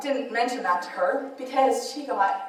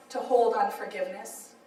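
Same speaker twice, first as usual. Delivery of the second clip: ordinary talk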